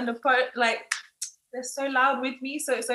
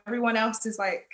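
ordinary talk, nothing marked

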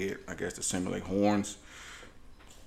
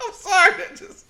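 high giggling apology; can't stop laughing; real fit of giggles; 4 dB louder than usual. Real, not acted. Cracking up over story.

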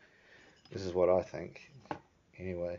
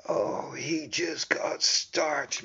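hushed commentator; 9 semitones higher, much slower than usual. Retold or imitated.

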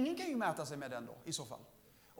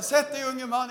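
louder, higher mock command; imitating stern preacher; 4 semitones higher, 9 dB louder than usual. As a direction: boss him down like the preacher. Preacher telling him to sit. (härmar predikanten som befaller)

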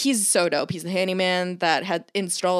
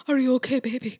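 tender to treadmill; 4 semitones higher than usual. Retold or imitated. Talking to her treadmill in the rain, tenderly cooing. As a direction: coo at it like a pet. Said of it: "I'm just like".

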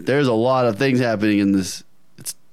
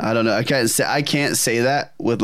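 ordinary talk, nothing marked

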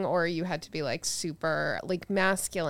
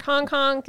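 mock goose honk; 8 semitones higher, 6 dB louder than usual. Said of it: "then you were like".

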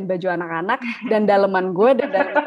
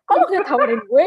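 higher, louder indignant question; indignant complaint; 6 semitones higher, 8 dB louder than usual. Retold or imitated. Host replays her complaint to her husband, higher and louder with indignation. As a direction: demand why you weren't offered. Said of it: "Gue kayak gitu langsung".

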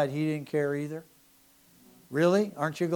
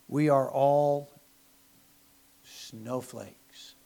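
mock dramatic pause; much slower than usual. Mocking modern culture.

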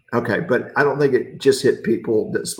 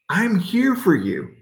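higher, pointed 'here' in a fed-up reproach; exasperated; 7 semitones higher than usual. Pretend. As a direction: snap 'I'm here for you' as a reproach. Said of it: "That's what I would have said".

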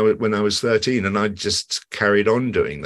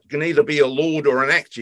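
quoted spark's line; 7 semitones higher than usual. Retold or imitated. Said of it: "He says".

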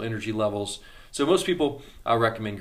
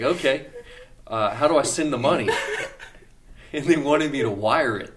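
laughing; 4 semitones higher, 5 dB louder than usual. Real, not acted. Laughing retelling the wire transfer.

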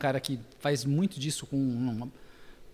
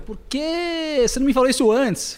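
high, louder mock exasperation; retold exclamation; 9 semitones higher, 5 dB louder than usual. Director to would-be narrator, high exclaimed reproach. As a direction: demand it as a playful reproach.

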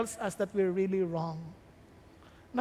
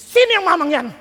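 loud, indignant question; angry demand; 5 semitones higher, 12 dB louder, faster than usual. Acted. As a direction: demand who the man is. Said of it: "galit si David". (galit na tanong ng hari)

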